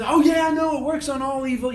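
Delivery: imitating excited students, high-pitched; eager student reassurance. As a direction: gush it out like an overeager student. Acting out eager students' assurance.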